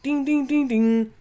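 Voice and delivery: high sing-song ding ding of a doorbell; doorbell imitation. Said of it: "he goes like this".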